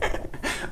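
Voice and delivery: soft breathy giggle; quiet laugh. Real, not acted. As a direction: let a quiet giggle slip. Laughs at own joke.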